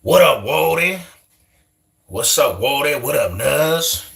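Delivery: high, drawn-out homie hello; imitated homies' greetings. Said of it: "then you had the nutties".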